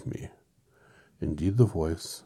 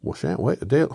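high, loud Irish Scarecrow; Irish accent; 7 semitones higher, 10 dB louder than usual. Acted. Reader retrying the Scarecrow's line, loud Irish accent. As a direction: protest loudly with a high Irish lilt. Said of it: "he becomes Irish every time".

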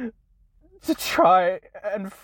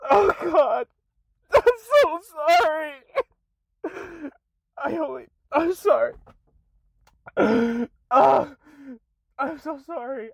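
breaking down crying; 3 semitones higher than usual. Real, not acted. Creator breaking down while apologising to followers, sobbing cry. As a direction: let the sob burst through.

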